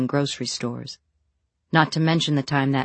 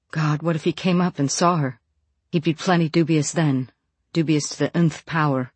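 ordinary delivery, nothing marked

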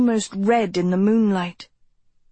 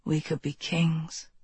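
whispering; 4 semitones lower, 8 dB quieter than usual. A narrator's reading. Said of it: "he whispered".

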